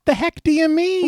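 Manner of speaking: high-pitched incredulous question; indignant disbelief. Real, not acted. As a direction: challenge it in exasperated disbelief. Indignant at the killer's line.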